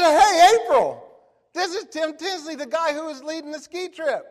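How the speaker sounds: high, loud, enthusiastic hello; hearty phone greeting. Retold or imitated. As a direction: re-enact greeting a caller with big excitement.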